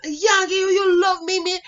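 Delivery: put-on high, loud Jamaican accent; imitated Jamaican boy. Jilted boy complaining about the Yankee, in Jamaican accent. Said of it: "he was Jamaican".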